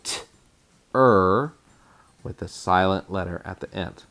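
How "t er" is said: "t-er" said as a clipped t then er; phonetic spelling sound. Shown as a demonstration. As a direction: sound out "t-er" as two crisp parts. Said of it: "So I'm gonna say".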